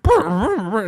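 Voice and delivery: high, loud brr brr with a laugh; high trumpet buzzing. Retold or imitated. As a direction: sputter like a clogged trumpet. Imitating broken trumpet sound.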